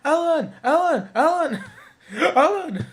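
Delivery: high, loud repeated name call; commercial imitation. Mimicking a commercial for the viewers, high comic shouting. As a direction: shout the name like the advert, breaking into laughter. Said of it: "Kinda like that commercial".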